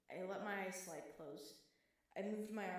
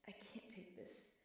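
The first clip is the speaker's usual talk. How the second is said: broken whisper; whispered despair; 7 dB quieter, slower than usual. Acted. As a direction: barely breathe the words out. Breaking down, tear falls.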